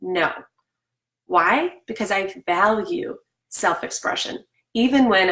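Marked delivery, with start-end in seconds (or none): none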